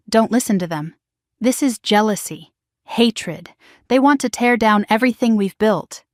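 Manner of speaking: plain reading voice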